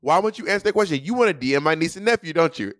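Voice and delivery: high-pitched accusing questions, retold; badgering. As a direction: corner them like a mock prosecutor. Retold badgering of evasive opponent.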